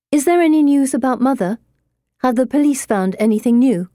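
fast, urgent questions; urgent and pressing. Acted. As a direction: press hard for news. Daughter urgently asks about mother.

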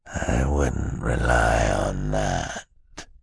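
very slow, low villain menace; terrible voice. A narrator's reading. Villain answering the triumphant children, terrible voice. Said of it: "in a terrible, terrible voice".

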